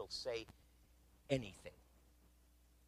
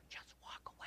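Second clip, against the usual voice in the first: voiceless near-silent words; mouthed; 17 dB quieter than usual. Retold or imitated. Wife mouthing to daughter, soundless. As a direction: barely breathe it. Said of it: "she mouthed".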